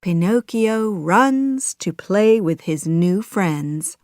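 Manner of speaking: ordinary delivery, nothing marked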